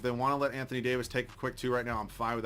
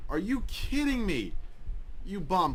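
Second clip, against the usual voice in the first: exasperated disbelief; 6 semitones higher, slower than usual. Real, not acted. Angry at the game.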